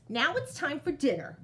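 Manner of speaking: plain reading voice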